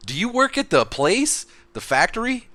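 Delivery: put-on high, loud, slow fifties kid voice; wholesome 1950s kid. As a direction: ask like an innocent old-timey boy. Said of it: "We're gonna go back to the sixties".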